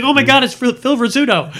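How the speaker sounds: high-pitched imitated fan excitement; mock freak-out. Retelling how the guys spot Rizzuto, a mock freak-out. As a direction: act out starstruck guys losing it. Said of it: "And they freak out".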